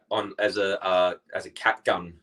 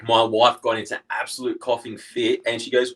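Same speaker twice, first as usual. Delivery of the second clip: normal talking voice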